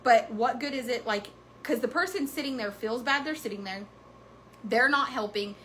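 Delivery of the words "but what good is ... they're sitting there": ordinary talk, nothing marked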